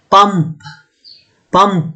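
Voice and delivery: পাম্প with a hard final p burst; demo word pump. Shown as a demonstration. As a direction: say পাম্প, closing the lips and popping the p. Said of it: "যেমন হচ্ছে". (শেষের প জোরে ছেড়ে পাম্প বলা)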